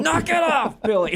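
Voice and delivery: high, loud shout. Retold or imitated. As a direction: yell it to stop him. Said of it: "have Greg be like".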